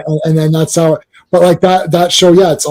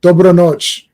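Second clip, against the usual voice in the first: loud Croatian; 8 dB louder, slower than usual. Shown as a demonstration. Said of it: "That's good night".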